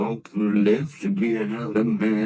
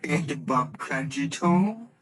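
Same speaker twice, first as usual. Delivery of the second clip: ordinary talk, nothing marked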